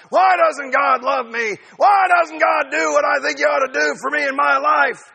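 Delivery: hammed-up wail of self-pity; mock complaining shout. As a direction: cry out to heaven like a hurt child. Acting out a whiny complaint.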